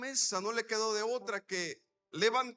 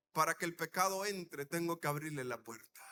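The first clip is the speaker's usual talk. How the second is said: lower, measured restatement; grave repetition; 7 semitones lower than usual. Shown as a demonstration. Preacher repeats the key point for the congregation, low and grave. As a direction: drop the pitch and let it sink in. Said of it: "Déjeme decirlo una vez más".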